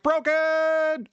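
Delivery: yelled answering message. Retold or imitated. Voicemail greeting for the ISP, yelled high. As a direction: bark it as the whole message. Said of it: "I'm just gonna be like".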